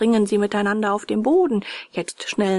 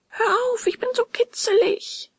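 much higher, giggly tiny voice from inside the log; ticklish little wood voice. A narrator's reading. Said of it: "kichert's und lacht's". (hohes, kicherndes Holzstimmchen)

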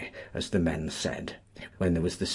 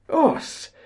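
excited cry; 5 dB louder than usual. A narrator's reading. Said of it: "cried Sam".

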